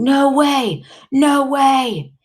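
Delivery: high, drawn-out cry of refusal; frantic denial. A narrator's reading. Boy refusing the nurses' bad news, frantic denial. Boy going nuts in grief.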